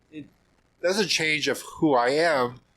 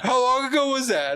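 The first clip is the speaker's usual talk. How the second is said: imitating an eager friend, much higher; keen, high-pitched question; excited question; 11 semitones higher, 4 dB louder, faster than usual. Retold Paulo asking excitedly.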